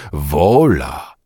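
long, lingering single word, falling; drawn out. A narrator's reading. Wszebor muses on Bugaj's word, drawing it out. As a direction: stretch the vowel right out. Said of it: "przeciągnął Wszebor".

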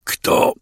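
breathless groaned word. A narrator's reading. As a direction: groan it out, winded. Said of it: "stęknąłem".

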